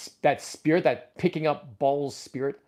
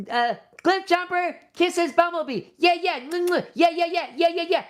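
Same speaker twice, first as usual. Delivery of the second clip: high squeaky plush chatter; squeaky toy voice; 13 semitones higher than usual. Pretend. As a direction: voice two toys smooching. Toys kissing, squeaky voice.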